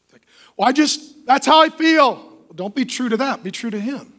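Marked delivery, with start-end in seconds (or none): 0.6-2.2 higher, louder, mocking a woman who goes by feelings; hammed-up emotional excuse; mock whiny woman. Preacher mocking an emotional wife, in a higher, louder mock voice.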